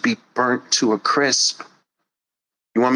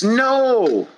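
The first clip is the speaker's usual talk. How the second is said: emphatic no; sharp no; 10 semitones higher than usual. Acted. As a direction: shut it down hard. Cutting off objector.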